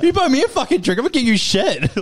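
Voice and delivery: high-pitched, worked-up comeback; exasperated, acted-out retort; retold indignant refusal. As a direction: snub the mate in mock outrage. Acted phone quarrel, refusing to pay.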